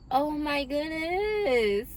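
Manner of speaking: slow, high "Oh my goodness" bursting with joy; holding back a yell of delight; overjoyed. Real, not acted. Reader thrilled after the love message, overjoyed gasp. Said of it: "I wanna yell".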